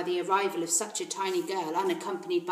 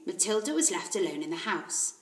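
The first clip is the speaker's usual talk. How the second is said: ordinary delivery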